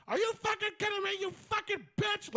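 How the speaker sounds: high-pitched, fast imitation of a screaming coach; mock coach scream. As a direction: shriek it like a furious coach up in a player's face. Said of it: "screaming at him".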